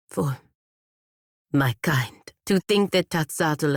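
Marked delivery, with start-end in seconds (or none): 0.1-2.3 whisper. Acted. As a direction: whisper the last words. Quiet personal confession.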